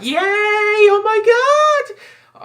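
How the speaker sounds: high, drawn-out fanboy squeal; mock cheering in a very high, stretched voice; imitated excited crowd. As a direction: squeal it like a thrilled crowd of geeks. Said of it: "Everyone was like".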